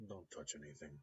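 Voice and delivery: put-on quiet, light Russian accent on "don't touch anything"; the mechanic's polite warning in a light Russian accent. The mechanic warns the neighbor, softly, in a Russian accent. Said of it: "hint of a Russian accent".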